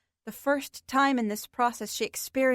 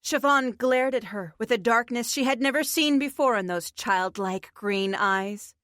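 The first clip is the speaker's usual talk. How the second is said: usual speaking voice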